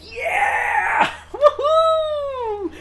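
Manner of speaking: loud, high victory shouts; excited cheering. Real, not acted. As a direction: cheer like you just won. Triumphant cheering after two yeses.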